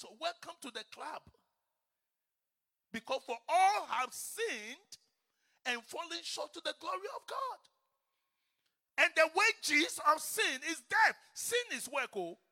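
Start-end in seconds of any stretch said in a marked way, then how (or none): 2.9-12.4 "all" and "death" shouted loud and high; preaching shout. Shown as a demonstration. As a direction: thunder the verse at them.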